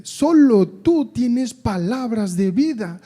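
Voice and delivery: high, loud, slow cry to the Lord; pleading Peter. Acted. Simon Pedro answering Jesus, high and loud pleading. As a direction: beg him as if nothing else remains.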